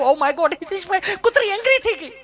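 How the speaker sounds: high, affected shocked city lady; mincing posh tourist. Retold or imitated. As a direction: gasp it out like a pampered lady. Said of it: "ચીપી ચીપીને બોલે". (ચીપી ચીપીને નવાઈથી)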